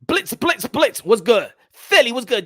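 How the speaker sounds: high, excited chant of blitz; hype call-out. Real, not acted. Streamer hyping up his chat with a high excited chant.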